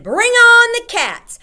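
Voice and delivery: very high, very loud, slow battle cry; boy rallying, full-voiced and drawn out; triumphant shout. A narrator's reading. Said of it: "I shouted".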